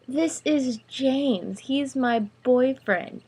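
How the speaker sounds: put-on high, slow girl's introduction; girl showing off. A narrator's reading. Girl presenting her boyfriend to Greg, showing off.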